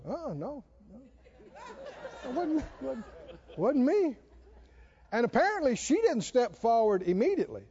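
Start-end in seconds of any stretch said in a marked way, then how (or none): 0.1-4.2 mock denial. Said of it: "everybody backed up and go".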